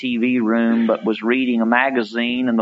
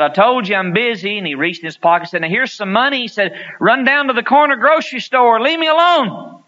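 much higher, louder, fed-up father; impatient dad; 10 semitones higher, 4 dB louder, faster than usual. Retold or imitated. Dad at the TV sending his son off to the store, fed up. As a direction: push him away with rising impatience. Dad shoos boy, more irritated.

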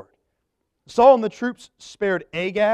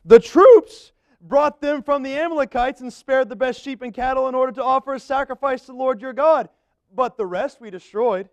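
high, earnest excuse-making Saul; 6 semitones higher, 5 dB louder than usual. Acted. Saul explaining to Samuel why the livestock were spared, high and eager. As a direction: plead the excuse in a higher voice.

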